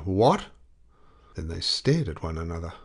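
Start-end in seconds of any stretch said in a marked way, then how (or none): 0.0-0.5 a startled, louder what; sudden surprised yell; yelled in surprise. A narrator's reading. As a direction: blurt it out in shock. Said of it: "they both yelled out together".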